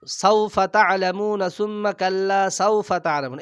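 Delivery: ordinary talk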